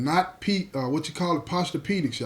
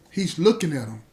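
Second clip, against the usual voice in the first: usual speaking voice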